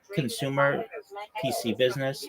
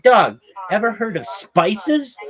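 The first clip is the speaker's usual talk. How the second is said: slow, higher, louder disbelief; incredulous retort; 7 semitones higher, 7 dB louder, slower than usual. Retold or imitated. Ex-employee mocking someone who never heard of it, slow incredulous jab. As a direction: drag it out like it's obvious, raised up. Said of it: "Be like".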